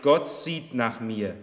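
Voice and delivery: ordinary talk, nothing marked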